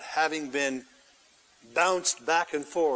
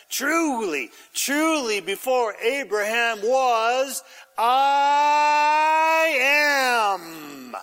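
proclaiming; 5 semitones higher, 4 dB louder, much slower than usual. Acted. Jesus declaring his divinity.

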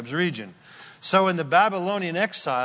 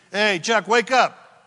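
louder, higher wake-up call; surviving soldier calling to his dead tentmate, raised and urgent; calling to wake a friend; 5 semitones higher, 5 dB louder, slower than usual. Retold or imitated. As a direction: shake a sleeping buddy awake with your voice. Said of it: "he looked over and he said".

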